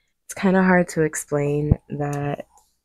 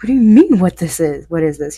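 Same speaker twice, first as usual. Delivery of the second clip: mock exasperation; 10 dB louder, faster than usual. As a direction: act out an incredulous retort to husband. Retold exasperated reply to husband.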